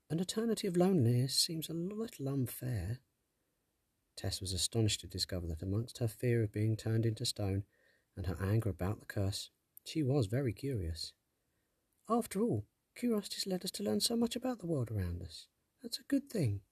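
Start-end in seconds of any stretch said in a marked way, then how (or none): 0.1-3.0 put-on young girl voice. Tess argues with sprite. 12.1-16.6 put-on higher, earnest girl; arguing girl. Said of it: "she argued".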